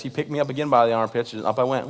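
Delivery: normal talking voice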